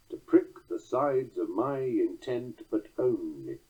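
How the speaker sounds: slow beat on PRICK, SIDES, MY, TENT, ON in "To prick the sides"; scanned weak ending. Shown as a demonstration. Lecturer showing the extra syllable, slow scansion with "only" falling away. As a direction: let the last syllable of "only" drop, unaccented. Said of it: "examine this orphan or rogue unaccented".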